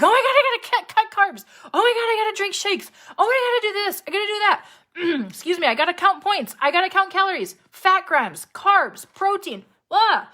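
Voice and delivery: high, loud, breathless mock panic; hammed-up panicky dieter listing rules. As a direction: rattle off the diet rules in a frantic squeal. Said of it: "all those little panicky actions".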